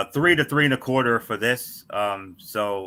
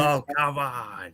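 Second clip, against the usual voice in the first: higher, slow, drawn-out mock protest; exasperated outburst; 5 semitones higher, 4 dB quieter, slower than usual. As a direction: drag it out in disbelief. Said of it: "you hear me do the".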